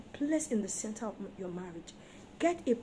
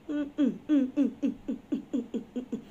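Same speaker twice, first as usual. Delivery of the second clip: mocking hum. Real, not acted.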